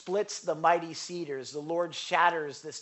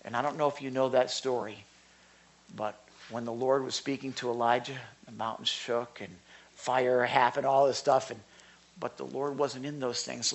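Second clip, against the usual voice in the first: usual speaking voice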